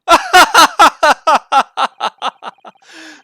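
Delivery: high, very loud staccato cackle trailing off; evil villain laugh. Acted. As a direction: cackle like an exposed schemer. Villainous laugh for exposed ploy.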